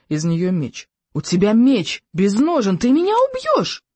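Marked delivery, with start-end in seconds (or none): 1.1-3.8 slow warning climbing to a very high wail; alarmed yell. A narrator's reading. Boy warns attacker his sword is bare, rising yell. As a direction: spell out the danger, then shoot up high. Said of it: "завопил Икинг".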